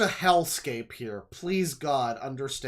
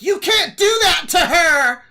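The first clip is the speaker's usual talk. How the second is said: much higher, much louder protest yell; indignant bystander bellowing at the police; outraged bystander shout; 16 dB louder than usual. Retold or imitated. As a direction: shout it like a stranger stepping in to defend her. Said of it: "every person around is going to be like".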